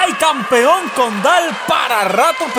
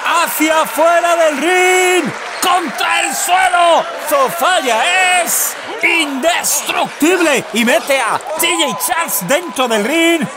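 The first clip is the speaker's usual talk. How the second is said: wrestling commentator; 6 semitones higher than usual. Real, not acted. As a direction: call the action with rising excitement. Play-by-play builds to near fall. (narrador de lucha libre emocionado)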